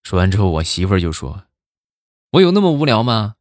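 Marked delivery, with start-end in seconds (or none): 2.3-3.4 exasperated wife. Retold or imitated. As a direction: imitate a wife losing patience, pitch jumping up. Wife snaps at clueless husband.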